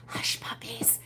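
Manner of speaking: unvoiced whisper, barely there. Husband secretly whispering the answer to his wife, hushed whisper. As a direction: breathe it like a secret prompt. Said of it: "he's whispering".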